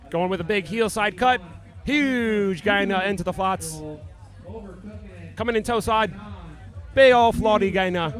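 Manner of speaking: hammed-up Aussie commentator; Australian accent. Commentator calling Colin's run in mock Australian accent. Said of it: "try to do our Australian accents".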